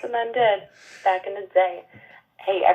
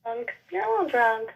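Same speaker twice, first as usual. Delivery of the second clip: soft, higher retold reassurance; placating aside; 6 semitones higher, 5 dB quieter than usual. Co-host retelling her reply to drunk woman, softly placating. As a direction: let a drunk stranger off gently and quietly.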